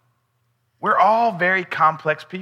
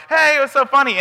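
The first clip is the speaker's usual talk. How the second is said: put-on kid's voice; 10 semitones higher, faster than usual. Kids quoted talking to him.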